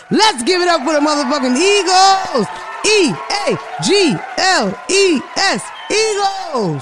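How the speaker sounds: high, loud chant spelling out the team; rally the room like a stadium crowd; whooping sports cheer; hype cheer. Real, not acted. Cheering Eagles going to Super Bowl.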